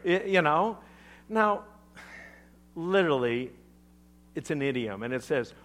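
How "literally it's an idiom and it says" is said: normal talking voice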